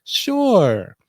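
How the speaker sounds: a high, bright-pitched receptionist. Acted. Receptionist answering the caller, high and upbeat. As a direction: chirp it out as a cheerful receptionist. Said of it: "the receptionist will say".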